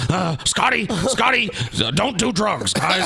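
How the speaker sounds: high, goofy McGruff crime dog voice; hammy cartoon dog PSA voice. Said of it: "his Scotty impersonation sound like a cartoon dog".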